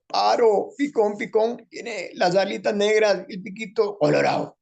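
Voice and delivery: high, slow, squeezed put-on voice; sing-song it in a thin high voice; radio character in a high strained voice; changed character voice. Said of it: "empezó eso del cambio de voz".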